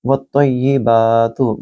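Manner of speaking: वत्तय्यिबातु with a long बा, slowly; long vowel. Shown as a demonstration. Teacher isolating the word, slow recitation. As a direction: hold the बा long. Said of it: "बा को भी लंबा करेंगे".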